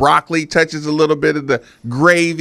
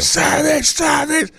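higher-pitched mock wrestler chant; half-voiced ring showman, pitched up; Macho Man Randy Savage; 7 semitones higher, slower than usual. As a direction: imitate a wrestler rallying the crowd. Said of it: "and they're just like".

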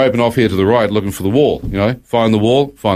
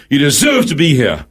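higher, forceful condemning voice; accusing shout; 5 semitones higher than usual. Retold or imitated. Another man in darkness condemning the newcomer, accusing mock shout. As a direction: hurl it as a harsh accusation.

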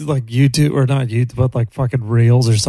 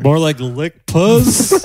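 slow punchline; 3 semitones higher, much slower than usual. Retold or imitated. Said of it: "And I'm like".

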